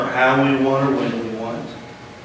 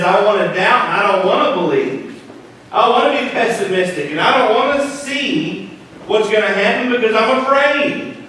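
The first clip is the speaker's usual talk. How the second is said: rising fervent plea; 8 semitones higher than usual. Acted. Voicing doubting inner voice.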